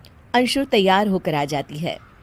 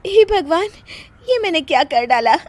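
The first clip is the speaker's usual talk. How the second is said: dismayed gasp; 8 semitones higher than usual. Acted. Kirti dismayed at her mistake.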